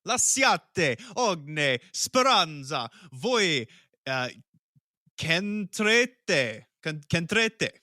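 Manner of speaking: put-on slow, theatrical Italian accent, each syllable savoured; mock Italian accent reading Dante's gate inscription, drawn out; hammed-up Italian accent. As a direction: roll out the Italian like a grand opera line. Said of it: "do you wanna try it with your best Italian accent".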